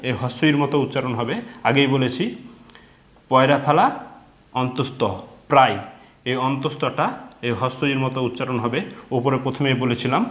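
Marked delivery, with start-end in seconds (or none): none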